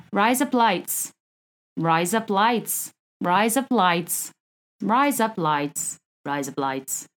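slow, drawn-out put-on Australian; Australian accent. Shown as a demonstration. Host demonstrating a trick phrase, Australian accent. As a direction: repeat it in a hammed-up Australian accent. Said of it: "in an Australian accent".

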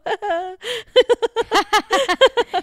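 high, loud burst of giggles; long giddy cackle, high-pitched; giddy laugh. Retold or imitated. Retells her giddy chipper reaction.

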